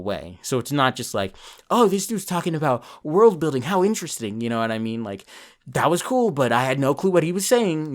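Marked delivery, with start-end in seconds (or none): 1.7-4.4 higher-pitched mock viewer interest; imitating casual viewer. Imagined viewer's superficial reaction, acted out. 5.7-7.9 higher-pitched imagined viewer reaction; imitating clueless viewer. Imagined confused viewer's reaction, acted out.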